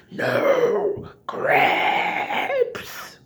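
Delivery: drawn-out, high mock-demonic cry; possessed movie voice. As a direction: imitate someone possessed. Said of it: "have you guys seen I'm Gonna Get You Sucker".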